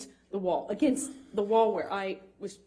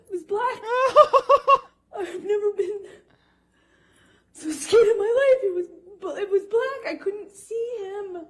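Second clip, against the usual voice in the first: high, cracking voice between laughs and tears; fighting back tears while telling it; tearful laughing cry; 9 semitones higher, 7 dB louder than usual. Real, not acted. Woman recounting her attacker's attack, tearful and shaking.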